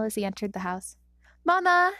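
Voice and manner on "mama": loud high call; yelling. A narrator's reading. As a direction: call out cheerfully across the house. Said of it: "Izuku yelled".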